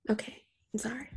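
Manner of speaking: very soft, whispery sorry; hushed apology. Real, not acted. Gushing co-host apologises for getting carried away, hushed. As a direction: drop to a near-whisper to apologise.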